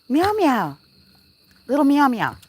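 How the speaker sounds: high, sing-song cat meows; playful meowing. Pretend. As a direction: meow up at the kitties playfully. Meowing back at the cats.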